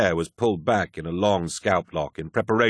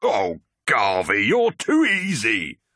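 slow, drawn-out, high and loud ribbing; gleeful teasing; 5 semitones higher, 5 dB louder, much slower than usual. Acted. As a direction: crow at the friend you fooled. Torgadon cracks, ending the prank.

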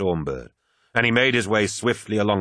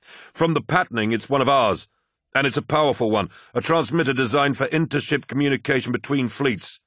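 put-on higher, urgent young officer; flight officer voice; 7 semitones higher, 3 dB louder than usual. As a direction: voice a tense, hurried officer.

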